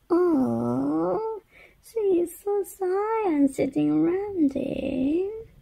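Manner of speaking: high tender coo. Acted. Shy armadillo for baby.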